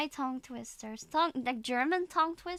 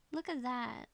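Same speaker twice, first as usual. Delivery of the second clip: usual speaking voice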